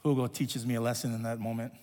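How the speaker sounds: usual speaking voice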